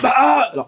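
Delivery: high, loud roller-coaster scream. Retold or imitated. Dad screaming as the ride launches, high and loud. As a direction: let out a sudden yell of terror. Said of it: "I actually started shouting, like, screaming".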